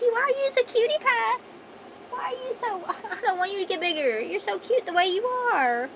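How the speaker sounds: gushing pet voice to a tiny cat; talking to my cat. Acted. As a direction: coo at the cat like it's a baby. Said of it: "Sorry, I was talking to my cat".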